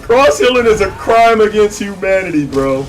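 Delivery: loud, high-pitched exclaiming after a laugh; laughing outrage. Real, not acted. Player judging a terrible cross heal, outraged and amused. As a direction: protest loudly, still tickled by it.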